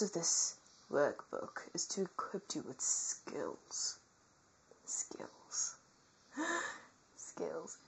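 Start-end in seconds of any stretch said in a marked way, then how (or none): 4.8-7.8 a single whispered word. Pretend. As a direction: breathe the word out. Whispered echo of skills.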